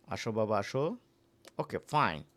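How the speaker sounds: normal talking voice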